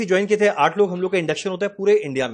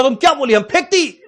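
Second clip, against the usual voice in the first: high-pitched mock South Indian Hindi, louder; 5 semitones higher, 3 dB louder than usual. Narrator mimics South Indian supervisor's Hindi, mocking. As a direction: imitate the South Indian man's broken Hindi, half-laughing. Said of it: "उसका tone का मजाक उड़ा रहा है".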